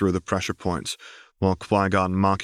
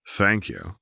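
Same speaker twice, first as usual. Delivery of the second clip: usual speaking voice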